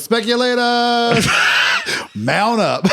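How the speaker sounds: slow mock rallying call with laugh; biker leader call. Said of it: "all I wanted to say was".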